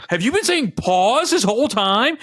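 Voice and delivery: high, loud, drawn-out retold disbelief; incredulous realization. Boyfriend realizing he misheard his partner, incredulous. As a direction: act out a stunned realization.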